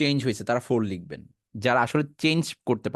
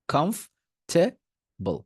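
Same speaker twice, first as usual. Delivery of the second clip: কমফ, ঠে, বল with a pause between each; three separate parts; 3 semitones higher, much slower than usual. Shown as a demonstration. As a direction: drill "khomf... the... bol" part by part. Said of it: "এরপরে just বলবেন বল". (কমফ, ঠে, বল আলাদা করে)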